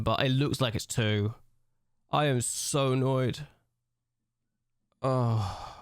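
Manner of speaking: ordinary talk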